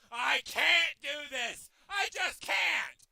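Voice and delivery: loud, slow, drawn-out wail; real frustrated outburst; despairing shout. Real, not acted. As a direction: yell it as if giving up. Giving up, shouting.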